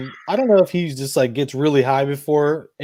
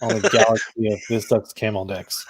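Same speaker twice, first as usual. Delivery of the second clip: normal talking voice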